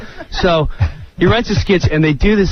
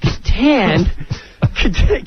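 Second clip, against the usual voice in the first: usual speaking voice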